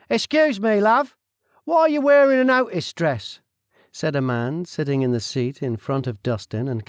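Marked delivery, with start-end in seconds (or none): 0.1-3.4 brash Cockney man, higher and louder; nosy Cockney stranger butting in; loud, cheeky Cockney voice; Cockney accent. Acted. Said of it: "He had a Cockney accent".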